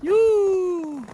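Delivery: high, loud whoop of joy; excited whoop. Real, not acted. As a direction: let out a happy yell. Cheering the catch.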